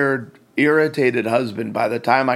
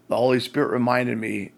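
ordinary talk

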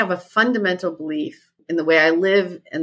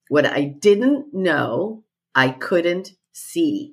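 "What I didn't know I couldn't see" at half pace; slow deliberate repeat; much slower than usual. Retold or imitated. Coach repeats her client's testimonial for emphasis, slow and deliberate.

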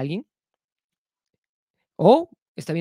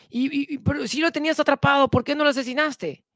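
puzzled question; 9 semitones higher than usual. Retold or imitated. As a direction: voice lifted high, baffled. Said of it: "le preguntaron".

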